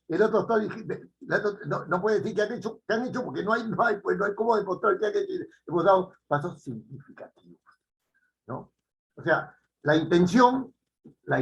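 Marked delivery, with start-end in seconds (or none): none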